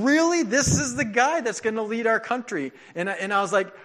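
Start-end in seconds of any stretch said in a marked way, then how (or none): 0.0-2.7 high incredulous "Really?", then a milder question; retold disbelief. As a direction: recall your own stunned doubt. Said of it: "And I'm thinking".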